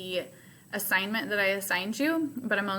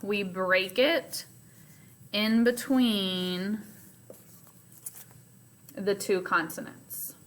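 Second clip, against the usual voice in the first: slow reminder. Shown as a demonstration. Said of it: "So remember".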